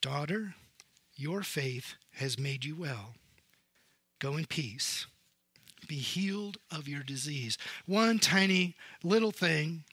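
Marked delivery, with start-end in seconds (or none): 0.0-7.6 tender blessing. Acted. Jesus speaks tenderly to healed woman.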